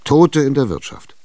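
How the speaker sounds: incredulous outburst. Acted. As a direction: throw it back at her in disbelief. Tschitschikow verblüfft, spöttisch. (ungläubig auflachend, höher und lauter)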